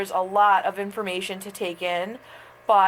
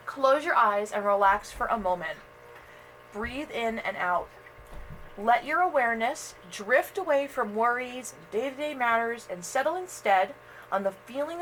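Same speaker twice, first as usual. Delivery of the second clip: guided meditation; 4 dB quieter than usual. Acted. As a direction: ease the listener into calm.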